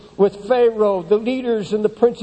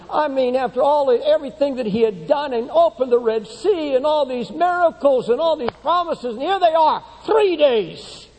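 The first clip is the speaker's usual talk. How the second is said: rising to a higher, urgent preaching pitch; 6 semitones higher than usual. Acted. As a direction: build it up to the peak.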